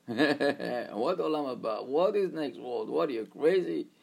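slow, incredulous mocking driver; scoffing driver. Driver scoffs at buying the next world, incredulous. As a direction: laugh off the idea.

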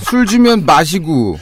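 slow slurred tipsy reading; drunk voice. Shown as a demonstration. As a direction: read it like a drunk tweet. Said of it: "술 취한 목소리로 해야 됩니다".

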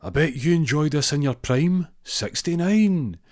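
cheeky bingo caller. Retold or imitated. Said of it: "he goes".

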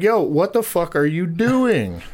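slow, high mock yell; retold confrontation yell. Retells confronting the man, repeated yell.

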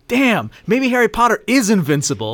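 doubting henchman voice. Retold or imitated. Voicing Death Eaters' thoughts.

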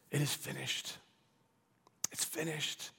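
soft, hushed voice of a painter stepping back; quiet satisfied painter. Pretend. Vincent quietly admiring his finished painting, hushed. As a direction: murmur it softly, admiring the finished canvas. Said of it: "he steps back and he says".

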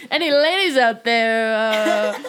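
hammed-up lonely hearts call; mock dating ad. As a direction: pitch yourself like a cheesy ad. Joking personal ad for herself.

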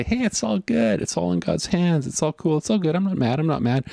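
high-pitched, put-on breezy reassurance; acting out forced okay-ness, pitched well up; mock breezy denial. Mock denial of one's anger.

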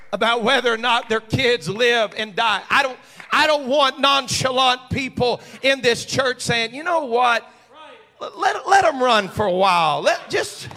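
loud, high preacher's build; preaching at full force. Acted. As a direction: drive it home to the congregation. Preaching about nonchalant parents.

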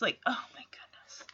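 hushed unvoiced oh my goodness; whispered aside. Real, not acted. Vlogger whispering to viewers about the oldies. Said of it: "whispered aside".

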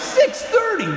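very high, loud comic squeal; hammed-up appalled student; horrified. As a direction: shriek it in mock horror. Student horrified by early Mass time.

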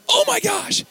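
very high-pitched mock shriek of alarm; panicked yelp. Preacher reliving the alligator snapping at him, panicked high yelp. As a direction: squeal it like a hammed-up panic. Retelling his startled alligator scare.

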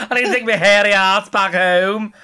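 high, loud hammed-up wail through laughter; laughing, theatrical imitation of a meltdown; mocking echo. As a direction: wail it back as comic self-pity. Mimicking co-host's self-pitying quote.

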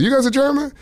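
mock surprise. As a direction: feign shock that they're German. Comic asks audience, acted quote.

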